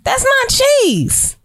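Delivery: indignant. Real, not acted. Mock outrage over vegan cheese.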